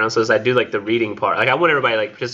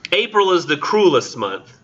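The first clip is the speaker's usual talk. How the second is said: higher, deliberate poetry recital; poem reading; 7 semitones higher, 4 dB louder, slower than usual. Acted. Lecturer opening Eliot's poem for the class, poem reading. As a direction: recite the poem's opening line, pitched up.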